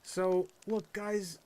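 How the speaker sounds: usual speaking voice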